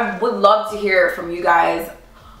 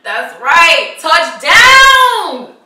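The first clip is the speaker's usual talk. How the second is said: loud gloating touchdown cry, building higher; triumphant shout; 8 semitones higher, 10 dB louder, much slower than usual. Acted. Player celebrates the winning score, triumphant shout. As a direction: shout it out in victory, soaring on the last word.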